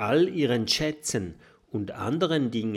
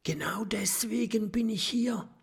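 breathy high whisper, glancing round; 12 semitones higher than usual. A narrator's reading. Professor tells the friends his secret, whispering. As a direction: let it out as a secret. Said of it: "flüstert".

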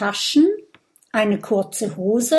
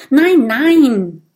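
loud, higher emphatic refusal; emphatic denial; 5 semitones higher, 9 dB louder than usual. A narrator's reading. Porcupine rejecting her friends' guesses, emphatic denial. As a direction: reject it firmly, raising the voice.

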